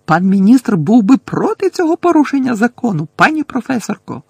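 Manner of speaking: high, loud desperate protest; urgent high-pitched shout. A narrator's reading. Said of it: "крикнула Герміона".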